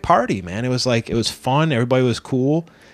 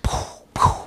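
imitated spitting puffs. Imitates spit flying.

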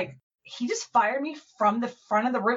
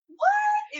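much higher shrieked What with a cry; shocked cry. Retold or imitated. Fired seminar worker crying out in disbelief, shocked shriek. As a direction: yelp it out in shock. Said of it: "And I was like".

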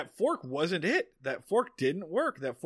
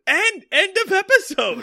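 squeaky laugh through the words; real giggling, pitched way up; 10 dB louder than usual. Real, not acted. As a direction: barely get it out for laughing. Cracking up over the ending.

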